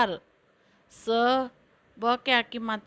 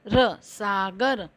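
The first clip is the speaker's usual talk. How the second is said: usual speaking voice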